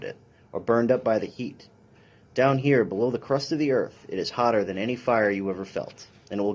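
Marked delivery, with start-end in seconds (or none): none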